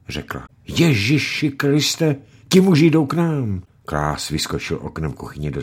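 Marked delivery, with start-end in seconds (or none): 0.7-3.6 high, frightened cry; wife spotting the bailiffs at the door, alarmed. Acted. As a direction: gasp it out in sudden fear. Mother sees bailiffs coming.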